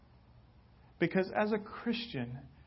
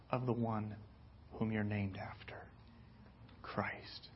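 a hushed, breathy question; whisper; 6 semitones lower, 11 dB quieter than usual. Real, not acted. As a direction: whisper the name reverently.

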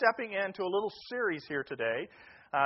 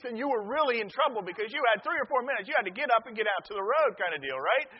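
normal talking voice

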